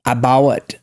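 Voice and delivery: "abawat" with a w glide splitting the vowel; Southern diphthong. Shown as a demonstration. Showing Southern speech, diphthongized "abawat". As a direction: break "abawat" open as AH plus WUT. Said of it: "but they say".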